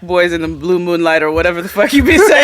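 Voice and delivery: loud mock dismissal breaking into high laughter; laughing mock telling-off. Real, not acted. Co-host mocking friend's blue moonlight talk, laughing loudly. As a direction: crack up while telling them off.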